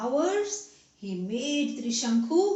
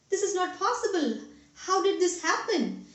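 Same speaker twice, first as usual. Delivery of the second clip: higher-pitched, astonished protest; shocked; 6 semitones higher, faster than usual. A narrator's reading. Devas seeing a human at heaven's gates, shocked. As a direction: react in disbelief, voice lifted. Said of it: "they were shocked".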